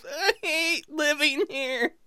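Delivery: high, soft, slow whimper; hammed-up tearful squeak, drawn out; mock crying elf. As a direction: whine pitifully as a crushed little elf. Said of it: "He cries".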